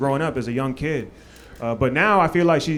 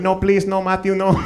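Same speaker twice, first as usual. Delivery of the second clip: put-on higher pleading, accented mother voice; praying mom; 4 semitones higher, 3 dB louder than usual. Mom praying for son's friend, mock plea. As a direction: beg like a worried praying mother. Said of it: "My mom in the corner praying for him".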